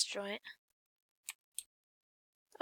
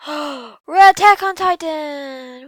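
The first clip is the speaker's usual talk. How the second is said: loud, slow, thrilled exclamation; excited shout; 4 semitones higher, 15 dB louder, slower than usual. Real, not acted. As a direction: announce it with a big gasp. Excited gaming announcement.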